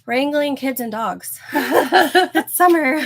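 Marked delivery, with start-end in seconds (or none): 1.5-3.0 laughing. Real, not acted. Laughing at chaotic household.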